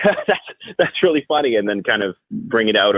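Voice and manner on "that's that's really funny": high, giggly delivery through a laugh; amused, laughing as you speak. Shown as a demonstration. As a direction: let a laugh break into the words. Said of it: "make something laugh or a joke".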